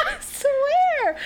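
high laughing insistence. Real, not acted. Mom laughing as she swears the trick worked.

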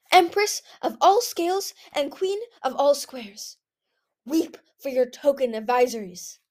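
high, grandiose victory proclamation; triumphant proclamation. A narrator's reading. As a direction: proclaim it like a gleeful empress. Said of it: "Whiteout shouted".

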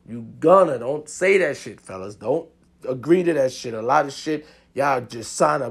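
usual speaking voice

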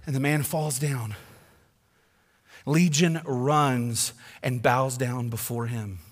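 ordinary talk

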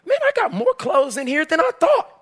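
high-pitched surprised exclamation; astonished retold reaction; mock amazement. Finds closet still full, acted reaction.

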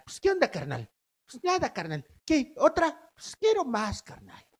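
lightly done Mexico City chilango slang voice; a hint of a chilango buddy asking for another; chilango accent. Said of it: "tienen un chilango adentro". (un toque de acento chilango de cuate)

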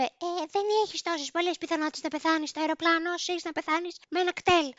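high-pitched put-on old lady voice; imitated granny. Granny butting in to the scared passenger, high put-on granny voice. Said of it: "μια γιαγιά προφανώς πετάχτηκε μπροστά και μου είπε".

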